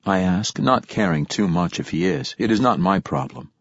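ordinary delivery, nothing marked

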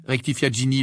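plain reading voice

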